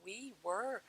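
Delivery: very soft, questioning repeat; soft wondering echo. Retold or imitated. Drowning woman echoing the cooing voice, very softly. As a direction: echo it hushed, ending on a rise.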